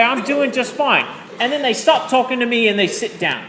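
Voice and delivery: terrible British accent. Shown as a demonstration. Teacher fulfilling a promise to her class, terrible British accent. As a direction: put on a bad British accent. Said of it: "tell this story in a British accent".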